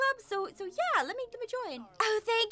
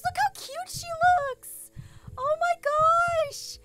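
high-pitched cooing over something cute; adoring fangirl coo; gushing; 11 semitones higher, slower than usual. Real, not acted. Gushing over how cute she looks.

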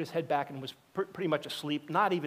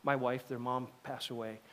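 subdued grief; 4 semitones lower, 6 dB quieter than usual. Retold or imitated. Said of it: "He says".

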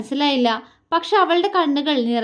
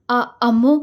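halting call of a name; breath-caught, emotional summons; stunned call; 4 dB louder, slower than usual. A narrator's reading. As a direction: stammer it out, moved. Stunned, moved man calls her.

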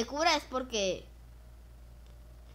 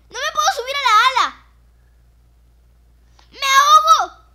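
loud high frantic cries; panicked drowning; 13 semitones higher, 15 dB louder than usual. Pretend. Drowning in game.

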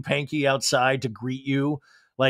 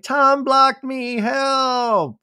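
put-on high, slow little-kid voice; toddler voice; 9 semitones higher, 3 dB louder, much slower than usual. Toddler Summer Moon pleading for help in a high little voice. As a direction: play the baby whining that Tom blocked her. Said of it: "Summer Moon is like".

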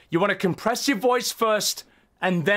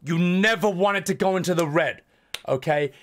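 ordinary delivery

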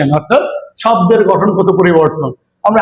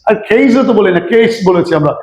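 ordinary delivery

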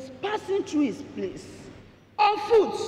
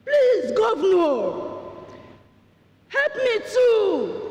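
crying out more; 7 semitones higher, slower than usual. Retold or imitated. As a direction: cry out harder, stretching each word. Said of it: "this man cried the more".

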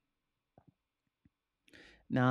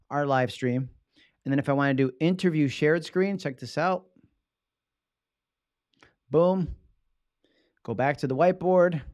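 ordinary talk

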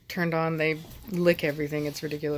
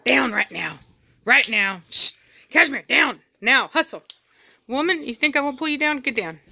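louder firm order; stern command; 6 semitones higher, 4 dB louder than usual. Real, not acted. Owner ordering Cashmere off Velocity, louder firm command. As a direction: order her down now.

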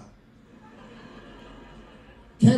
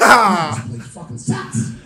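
slow, loud drawn-out complaint; frustrated shout; 5 semitones higher, 9 dB louder, much slower than usual. Retold or imitated. A dad ignored by the leaving crowd, shouting in frustration. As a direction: drag it out in a fed-up yell.

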